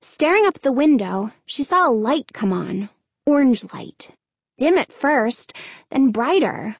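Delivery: plain reading voice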